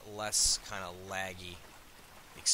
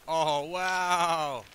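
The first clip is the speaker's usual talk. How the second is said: loud, higher, drawn-out exclamation; wowed outburst; loud amazement; 7 semitones higher, 8 dB louder than usual. Real, not acted. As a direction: exclaim in big surprise. Reacts to sudden lag.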